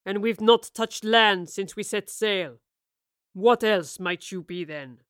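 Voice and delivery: calm threat from a weathered captain; captain coldly questions the stowaways; fearsomely calm captain. A narrator's reading. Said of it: "in a fearsomely calm tone".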